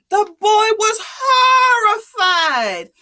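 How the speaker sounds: high, slow, loud cry; dramatic cry. A narrator's reading. Storyteller wailing the boy's horror, high and slow. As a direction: wail the word horrified.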